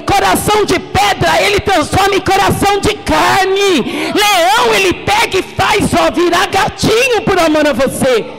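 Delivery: impassioned shouted exhortation; full-force preaching. Real, not acted. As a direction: preach in insistent building phrases, voice pushed up.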